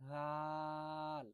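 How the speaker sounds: রাল held long, soft and thin; stretched soft letter. Shown as a demonstration. Teacher showing soft stretched letter to students. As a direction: draw রাল out softly. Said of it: "খুব soft হবে".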